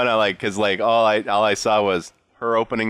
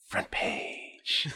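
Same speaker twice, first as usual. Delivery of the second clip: breathy whispered "Front page"; whispered catchphrase; 12 dB quieter than usual. Shown as a demonstration. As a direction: whisper it like a secret. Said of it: "her signature phrase that she says".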